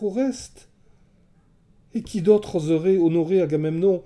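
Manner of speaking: rising hope, voice lifting; excited. A narrator's reading. Électre recognizes brother's lock.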